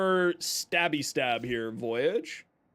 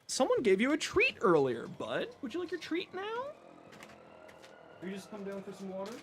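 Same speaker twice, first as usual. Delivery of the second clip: soft doting voice for a sleepy cat; pet talk; 6 dB quieter than usual. Real, not acted. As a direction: coo gently at your pet, mostly hushed. Baby talk to his cat.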